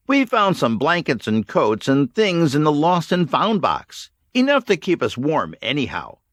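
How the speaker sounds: lightly voiced youth, matter-of-fact; a teen reporting to the leader; young man Brad. A narrator's reading. Brad's voiced reply.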